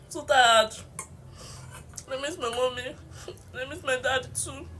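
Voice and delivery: real tears rising through a laugh. Real, not acted. Eating alone on camera, missing her parents, tearful. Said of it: "I'm not going to cry again".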